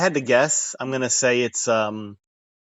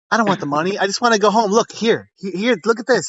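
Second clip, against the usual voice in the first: half-acted exasperated rant, pitched up; fed-up worker; 8 semitones higher than usual. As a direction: pretend to throw the money back at the boss, worn out. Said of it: "be like".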